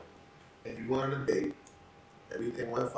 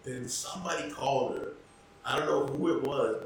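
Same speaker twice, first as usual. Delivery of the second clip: ordinary talk, nothing marked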